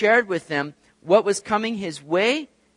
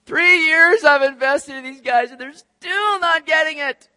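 Jesus exasperated; 9 semitones higher, 8 dB louder than usual. Retold or imitated. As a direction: groan at disciples who still don't get it.

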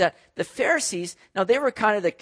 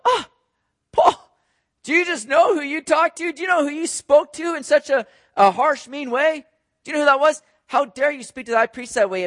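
higher, louder indignant challenge to Paul; indignant accusers; 8 semitones higher, 5 dB louder than usual. Retold or imitated. Priest's men scolding Paul, higher and louder indignant voice. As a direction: demand to know how he dared, voice raised high. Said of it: "They'll go".

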